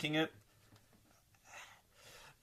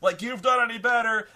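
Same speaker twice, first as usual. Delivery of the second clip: much higher, louder jeering voice; mock viewer yell; 10 semitones higher, 10 dB louder than usual. Imagined viewer heckles the reviewer, loud and high. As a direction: yell it at the screen. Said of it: "if you're yelling at the screen".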